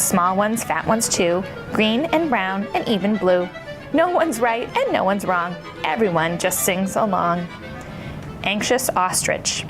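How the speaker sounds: usual speaking voice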